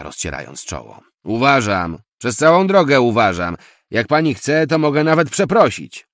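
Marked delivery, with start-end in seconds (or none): 1.2-6.1 loud, raised-pitch defensive protest; angry retort. A narrator's reading. Taxi driver defends his driving to passenger, loud and irritated. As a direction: argue back at full volume.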